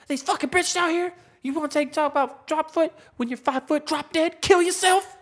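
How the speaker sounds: very high-pitched mock ranting; imitated freak-out. Retelling the breakfast spot meltdown, high mock rant. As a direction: act out a man flipping out, voice way up high. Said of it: "apparently he flipped out".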